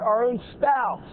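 normal talking voice

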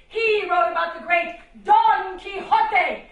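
booming storybook praise; grand declamation. Acted. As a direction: announce it like an epic. Grand praise of Don Quixote.

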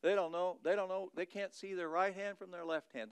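ordinary talk, nothing marked

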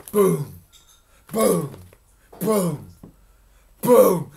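loud, spaced, falling booms; booming footsteps. A narrator's reading. Storyteller sounding the approaching giant's steps, booming. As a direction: thud each one out like a giant's step.

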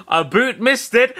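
put-on Canadian accent. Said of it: "as Roy might say".

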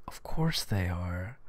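slow breathy whisper. Real, not acted. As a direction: whisper it to yourself. Whispered aside.

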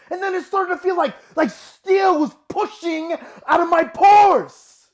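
high, loud, building to a shout; desperate, full-voiced dramatic shouting; anguished outburst. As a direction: cry it out in mounting torment. Monologue, torment builds to outburst.

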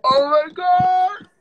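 high, loud, stretched-out excited cry; thrilled squeal. Real, not acted. Streamer greeting a fan who joins live, thrilled squeal. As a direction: squeal it slowly with a laugh.

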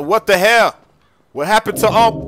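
high, loud outraged yell; angry yell. Acted. Finds the money gone.

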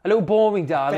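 high, loud put-on English accent; mock English accent. Co-host mocking the singer's boredom in an English accent. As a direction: ham up a posh English accent. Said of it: "I can't do it normal".

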